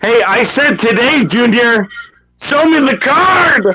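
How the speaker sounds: high-pitched impatient yell; angry demand. Real, not acted. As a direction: demand it like you've waited too long. Impatient demand to see card.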